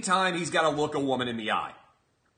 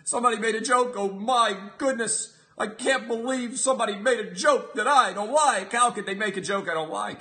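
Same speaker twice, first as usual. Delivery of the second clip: mock a fainting prude, higher; mock pearl-clutcher; 6 semitones higher than usual. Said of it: "I sound like an Italian man now".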